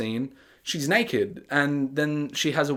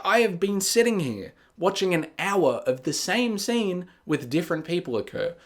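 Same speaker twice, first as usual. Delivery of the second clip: ordinary delivery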